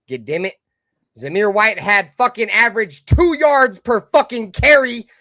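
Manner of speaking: loud, fed-up tirade with curses; angry rant at fans. Retold or imitated. Telling off Raider fans, loud and fed up. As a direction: rip into them like you've had enough. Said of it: "And I said this".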